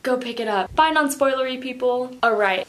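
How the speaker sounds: ordinary talk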